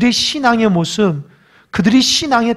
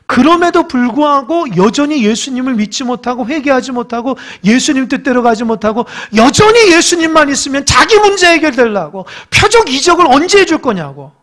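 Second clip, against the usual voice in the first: loud, higher rebuking sermon; fervent preaching; 5 semitones higher, 4 dB louder than usual. Acted. Pastor rebuking unbelief to the congregation, loud. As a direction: rise up and rebuke.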